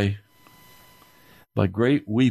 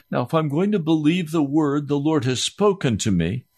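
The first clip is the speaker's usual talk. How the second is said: preaching higher, building in force; rising preaching; 6 semitones higher than usual. Acted. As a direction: press the point home from the pulpit.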